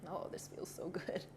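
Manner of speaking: breathy whisper of relief. Real, not acted. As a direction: whisper it as if sinking into the stretch. Whispered aside while stretching.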